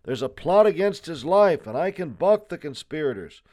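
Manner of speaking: high, urgent warning cry; shouted warning. A narrator's reading. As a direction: call out a warning to departing men. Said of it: "he shouted".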